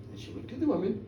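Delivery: usual speaking voice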